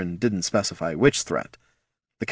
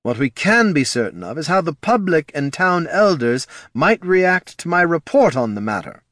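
high, pointed character voice; higher-pitched stern official; official giving warning; 8 semitones higher, 3 dB louder than usual. A narrator's reading. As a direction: warn them with veiled threat.

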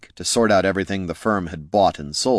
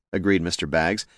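usual speaking voice

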